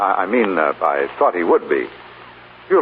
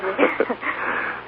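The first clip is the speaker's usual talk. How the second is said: high soft laugh; 14 semitones higher, 6 dB quieter than usual. Acted. Husband laughs off her worry.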